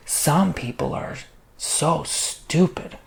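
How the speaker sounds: slow, drawn-out mock passive-aggressive jab; mimicked passive-aggressive remark, very slow. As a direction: imitate a snide put-down, stretching the words. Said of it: "like, passively, like, aggressive".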